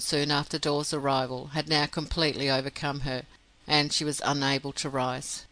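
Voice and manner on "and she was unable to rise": usual speaking voice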